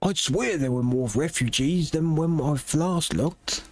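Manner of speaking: lightly voiced city officials talking over refugees; NPC dialogue voices. Officials discussing refugees in the city, measured and grave. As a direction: read it like a worried town elder.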